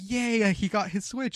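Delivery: put-on high squeal of a happy kid; excited kid. Worker imitating the excited boy, high squeal. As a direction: voice a thrilled child. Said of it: "the kid was like".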